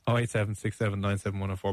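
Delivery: quick ad read. Acted. Joke phone number read fast.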